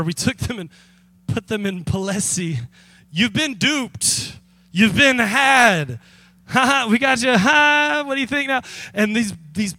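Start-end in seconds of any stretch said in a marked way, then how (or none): 3.1-8.6 mock gloating. Pranksters reveal the fake store.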